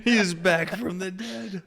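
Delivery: high, loud, slow proclamation; mock amazement. Friend announces the dead returned, mock amazement. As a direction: announce a miracle, hammed-up and drawn out.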